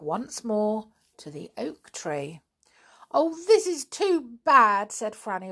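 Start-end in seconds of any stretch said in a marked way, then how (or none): 3.1-4.9 almost crying. A narrator's reading. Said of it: "almost crying".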